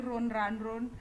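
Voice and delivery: "run, run, run" read as roon, ran, roon; mimicked mispronunciation. Teacher chiding second-semester students, mimicking "run" as "roon". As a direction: imitate the misreading, long oo in "run". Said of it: "masa baca kata kerja berlari".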